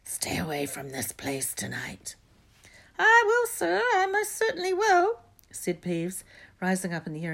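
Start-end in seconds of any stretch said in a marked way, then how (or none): 0.0-2.2 low, hoarse whispered warning; croaking. A narrator's reading. Harry warning Peeves off as the Baron, croaking. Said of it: "croaked Harry". 3.0-5.2 very high, loud, fawning poltergeist; greasy. A narrator's reading. Peeves apologising to the supposed Baron, greasy fawning.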